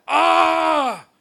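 high, loud demonic cry; the preacher voicing the demon's shout. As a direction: cry out like the possessed man. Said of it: "él decía".

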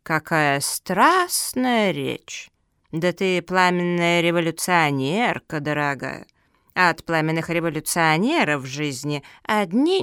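slow, drawn-out sardonic reasoning; unruffled old tortoise. Acted. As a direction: reason slowly, stretching key words.

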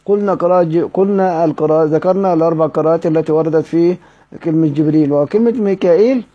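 ordinary talk, nothing marked

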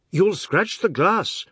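high, drawn-out outcry; alarmed cry. A narrator's reading. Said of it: "Mister Biswas cried".

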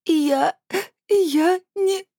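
high, loud sobbing denial; teen sobbing at her scolding aunt. Acted. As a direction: cry it through tears. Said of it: "рыдала Анжелика".

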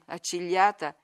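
ordinary talk

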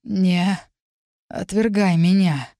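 slow, halting, effortful request; fading plea. A narrator's reading. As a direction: plead with the last strength. Last words before losing consciousness. (медленно, из последних сил)

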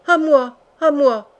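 loud, high call for rescue; repeated cry for help. A narrator's reading. Prisoner calls to the mad king again, loud cry for help. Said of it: "répéta Hardy".

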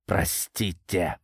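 slow, mocking mimicry of her apology; mocking imitation. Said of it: "кривляясь, передразнил он".

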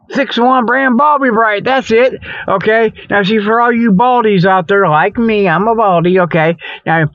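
ordinary talk

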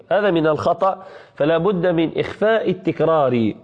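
ordinary talk

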